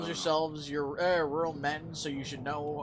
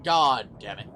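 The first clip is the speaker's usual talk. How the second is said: frustrated curse; 6 semitones lower, 6 dB louder than usual. Real, not acted. Player cursing the lost points.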